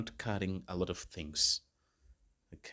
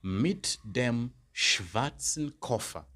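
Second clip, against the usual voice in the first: very slow, deliberate German answer; slow model answer; 3 semitones higher, much slower than usual. Shown as a demonstration. Teacher modelling the answer for students, very slow. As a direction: lay each word down slowly. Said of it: "Then I could say".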